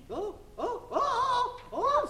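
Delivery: rising cries of pain; pained high yelps. Acted. Cramp while hanging in window.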